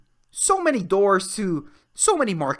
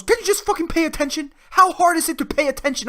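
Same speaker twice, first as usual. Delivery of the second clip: annoyed boss; 9 semitones higher, 4 dB louder, faster than usual. Retold or imitated. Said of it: "my boss goes".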